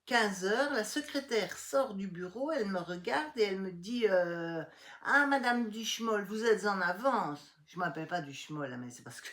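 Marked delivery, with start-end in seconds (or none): 5.1-7.6 higher, louder, slowing on the second part; sing-song mock receptionist greeting; a hint of a condescending secretary. Secretary voiced in retold story.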